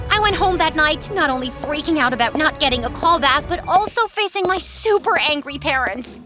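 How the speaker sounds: ordinary delivery, nothing marked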